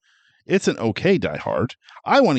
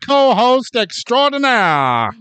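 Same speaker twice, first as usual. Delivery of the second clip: put-on slow, louder old radio announcer voice; 1940s radio announcer; 6 semitones higher, 3 dB louder, slower than usual. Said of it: "nineteen forties radio announcer".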